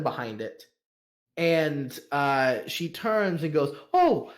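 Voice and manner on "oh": sudden high yelp of surprise; startled gasp. Acted. Madam caught at the desk, startled high oh. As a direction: jump as if caught out.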